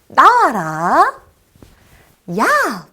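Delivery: loud, drawn-out summoning shout; loud call-out. Shown as a demonstration. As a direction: call the children to shout along. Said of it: "큰 소리로 해주세요". (큰 소리로 길게 외치기)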